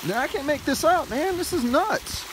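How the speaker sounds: high, loud disbelief; can't believe this is happening. Real, not acted. Disbelief at the weather.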